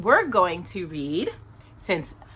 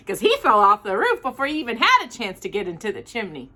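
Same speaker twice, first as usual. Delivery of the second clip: ordinary delivery, nothing marked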